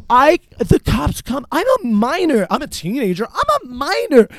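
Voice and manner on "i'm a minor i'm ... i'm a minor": retold teenage plea, jumping up high; high-pitched protest. Teen protesting to the cops, high mock panic. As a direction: whine it high like a protesting kid.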